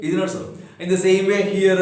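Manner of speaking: ordinary talk, nothing marked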